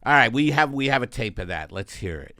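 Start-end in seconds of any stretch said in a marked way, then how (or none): none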